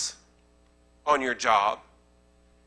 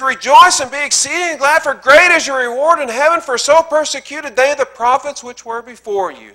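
the scripture again, much higher and louder; preached at full force; 10 semitones higher, 10 dB louder than usual. Acted. Pastor rereading the verse to his congregation, now shouted out high and loud. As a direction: proclaim Rejoice and be exceeding glad as a triumphant call.

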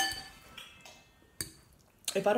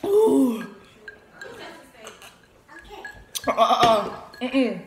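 loud burning-mouth groans; huffing through the heat; pained spicy gasps; 9 dB louder, slower than usual. Real, not acted. Reacting to spicy food.

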